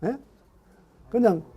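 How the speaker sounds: ordinary talk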